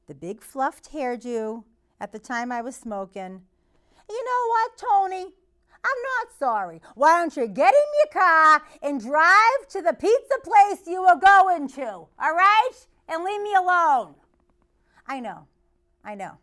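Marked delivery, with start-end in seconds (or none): 4.1-14.1 loud, high telling-off; teen shouting back at the driver; defiant yell. Retold or imitated. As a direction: tell him where to go. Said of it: "I tend to get loud".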